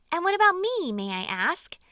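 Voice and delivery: a high, loud, offended protest; indignant. A narrator's reading. Miss Spider insisting she can spin too, indignant. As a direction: Miss Spider feeling left out, piping up. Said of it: "said Miss Spider".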